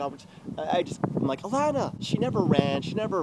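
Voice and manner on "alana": high-pitched call of a name; retold calling out. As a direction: imitate calling after her. Retold calling after her.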